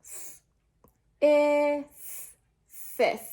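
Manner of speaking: "S-, is, sis" built up one sound at a time; teacher sounding out sis; sounding out a syllable. Shown as a demonstration. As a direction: blend S and is into "sis". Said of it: "sound that out".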